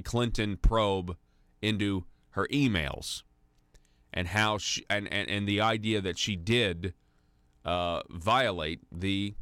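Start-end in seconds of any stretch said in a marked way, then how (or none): none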